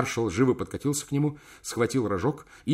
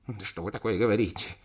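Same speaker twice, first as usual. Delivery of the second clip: usual speaking voice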